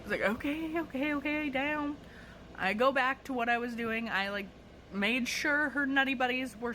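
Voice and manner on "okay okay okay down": high-pitched string of appeasing words; retold jittery placating; placating retreat. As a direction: back off nervously, pitch held high. Backing off from angry customer.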